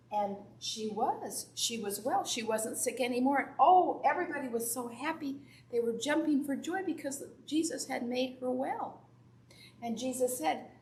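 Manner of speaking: put-on bright, lively Bible story for kids; children's sermon story voice; storytelling to children. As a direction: tell it to the little ones in church, animated.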